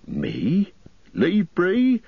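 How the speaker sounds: incredulous, leaping up high in alarm; startled, high-pitched protest; scared innkeeper. A narrator's reading. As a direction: refuse like a frightened landlord. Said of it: "looking really scared".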